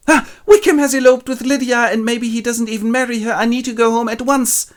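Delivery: high, loud panicked cry; alarmed Lizzie. Retold or imitated. Lizzie panicking about Lydia, high and loud. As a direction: panic at the news. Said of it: "she gets a message".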